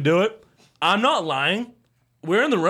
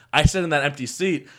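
ordinary talk

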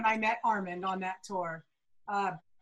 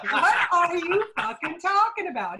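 loud, high, laughing disbelief; incredulous retort; 6 semitones higher, 5 dB louder than usual. Retold or imitated. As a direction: retell it as an exasperated, baffled demand. Retold escalating disbelief at a stranger.

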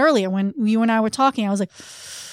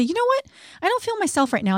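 sudden high-pitched start, then settling; a sample line, opening much higher; demo excusing yourself; 9 semitones higher than usual. Shown as a demonstration. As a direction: show how to step away mid-conversation. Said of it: "to say".

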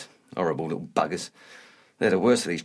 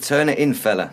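louder, irritated retort; insistent repeat; 2 semitones higher, 4 dB louder than usual. Acted. Telling his mate to stop the pie story, insistent repeat. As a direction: repeat it firmly for someone who missed it. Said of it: "I said".

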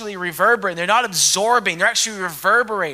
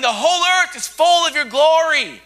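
higher, loud proclamation; shouting praise; 6 semitones higher, 3 dB louder than usual. Acted. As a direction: call it out across heaven. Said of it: "And they're shouting".

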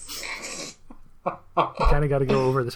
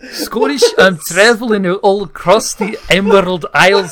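mock Scottish accent; 4 semitones higher, 6 dB louder than usual. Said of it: "you just went Scottish".

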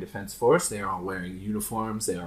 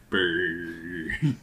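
lips buzzing a dead line; dial tone buzz; 4 semitones lower than usual. Acted. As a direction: imitate the dead phone line. Dial tone imitation.

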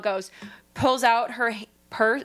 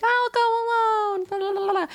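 mock brave Tinkerbell; 11 semitones higher than usual.

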